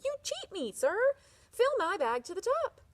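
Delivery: high, loud angry cry. Acted. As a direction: accuse the merchant furiously. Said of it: "he cried angrily".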